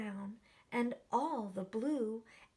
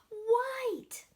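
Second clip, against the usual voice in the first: high, loud gasped shout; excited answer; 11 semitones higher, 4 dB louder than usual. Acted. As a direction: burst out with delight.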